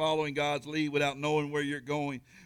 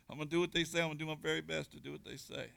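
fast, much softer, laughing; quick laughing aside; 5 dB quieter, much faster than usual. Real, not acted. Preacher laughs while promising to obey the doctors, quick and soft. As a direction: rush it under a laugh.

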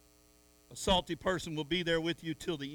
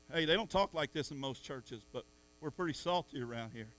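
ordinary talk, nothing marked

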